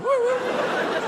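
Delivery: barely bother with the pig impression; lazy falsetto pig noise; half-hearted pig squeals. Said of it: "I just can't be bothered to do the impression".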